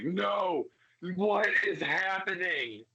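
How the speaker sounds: high, alarmed cry; high frightened outburst; startled fear. Acted. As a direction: jolt awake from a terrible vision. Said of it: "You're like".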